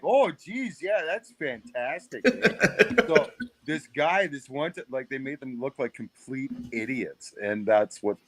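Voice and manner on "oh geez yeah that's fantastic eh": high, slow exaggerated Canadian "Oh, geez"; comic Canadian drawl, eh; hammed-up Canadian accent. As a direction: stretch it out like a dopey Canadian hoser. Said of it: "everything they said was like".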